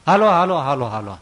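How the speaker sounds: urgent calling. Acted. Farmer loudly urging the guru to come. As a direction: hurry them up, loudly.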